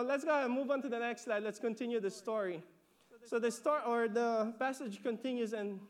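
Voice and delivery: usual speaking voice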